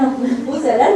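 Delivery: ordinary talk, nothing marked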